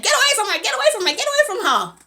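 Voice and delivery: high, loud repeated command; urgent warning shout. Retold or imitated. Store guy with gun to attacker, urgent shout. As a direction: shout the order over and over. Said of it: "comes out with his gun like".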